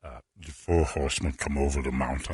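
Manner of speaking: low rumbling giant delivery; Andre the Giant. Retold or imitated. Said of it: "an Andre the Giant impression".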